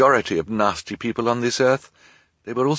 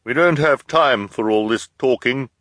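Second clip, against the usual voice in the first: loud, higher put-on voice; disguised voice; 5 semitones higher, 5 dB louder than usual. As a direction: disguise the voice urgently. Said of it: "hoping the associates wouldn't recognize his voice".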